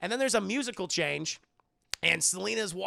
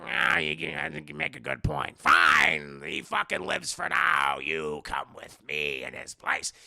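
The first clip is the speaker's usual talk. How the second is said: low, raspy grudging villain; hammed-up raspy concession; Danny DeVito's Penguin; 7 semitones lower, 5 dB louder than usual. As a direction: growl it, with a loud burst when giving in. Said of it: "Danny DeVito's penguin's like".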